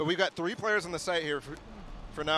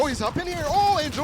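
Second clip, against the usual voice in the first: excited; 9 semitones higher, 6 dB louder than usual. Real, not acted. Tense fight, caster exclaims.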